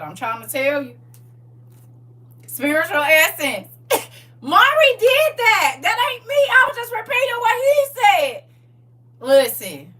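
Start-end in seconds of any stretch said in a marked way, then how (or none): 4.4-9.2 high, slow put-on voice; squeaky innocent denial; high mock voice. Defensive high voice.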